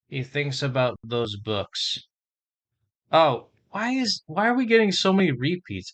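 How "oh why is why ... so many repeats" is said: exasperated surprise. Real, not acted. Annoyed at Duolingo repeats.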